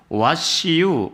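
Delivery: "와시유" drawn out very slowly like English; 영어처럼 들리게 아주 천천히 늘인 "와시유"; "What see you". Retold or imitated. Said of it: "지금 너는 무엇을 보고 있냐".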